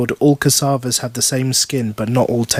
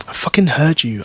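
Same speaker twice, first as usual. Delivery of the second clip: ordinary delivery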